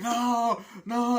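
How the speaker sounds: high, loud mock wail; acted anguished no; imitated crying girl. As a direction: cry out like a pleading girl. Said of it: "the girl's crying".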